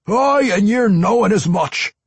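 very high, loud dwarf; Pwent. A narrator's reading. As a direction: belligerent dwarf brogue. Voices the dwarf vampire.

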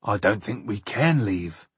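plain reading voice

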